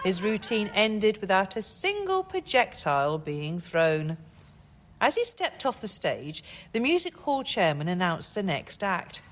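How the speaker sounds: plain reading voice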